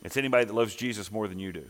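ordinary talk, nothing marked

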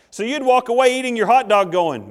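ordinary delivery

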